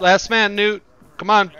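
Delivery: high, loud urgent call; shouting. Real, not acted. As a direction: yell across the room to a teammate. Calling teammate in firefight.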